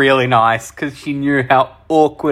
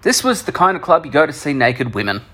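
ordinary talk

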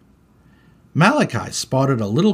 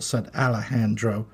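ordinary delivery, nothing marked

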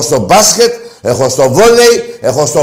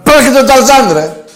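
louder, higher jeer; mocking shout; 7 semitones higher, 4 dB louder than usual. Real, not acted. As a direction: shout the joke back at the prankster. Host mocks prank caller. (κοροϊδευτική φωνή)

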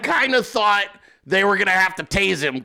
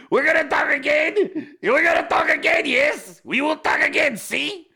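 hyped-up Milei impression; 7 semitones higher than usual. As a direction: gush like you can't contain yourself. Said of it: "He was so high energy".

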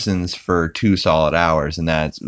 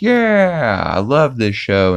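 excited cheer; 5 semitones higher, slower than usual. Retold or imitated. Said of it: "They're like".

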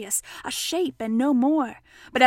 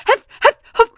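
short, puffed bursts; too winded to get the word out; out of breath; 11 semitones higher, 13 dB louder than usual. Acted. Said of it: "breathing so hard that he could hardly speak".

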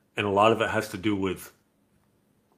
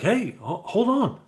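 high mock call of a boy chasing her; lightly imitated guy calling hold on; calling after her; 12 semitones higher, 5 dB louder, slower than usual. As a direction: call after a girl who walks away.

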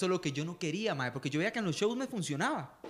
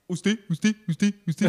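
punchy repeated catchphrase impression; mock impersonator's one-word routine; Ignacio Santos; 6 dB louder, slower than usual. Shown as a demonstration. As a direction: fire out the word in separate bursts. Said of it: "es que yo imito a Ignacio Santos".

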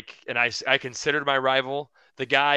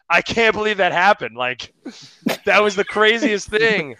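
higher and louder, thrilled; retold excitement, higher and louder; excited; 8 semitones higher, 5 dB louder than usual. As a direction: gush as if it was the best thing ever. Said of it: "so excited 'cause I'm like".

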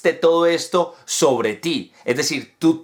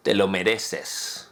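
sarcastic tone; 7 semitones lower, 5 dB quieter, slower than usual. Shown as a demonstration. Teacher demos the phrase with sarcastic bite. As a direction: lower and softer, slow and dry sarcasm. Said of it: "o en un tono sarcástico".

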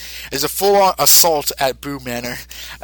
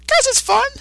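put-on very high childlike monster voice; Boo voice. Boo answering Mister Satan simply, in a very high childlike voice. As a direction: answer like an innocent little creature.

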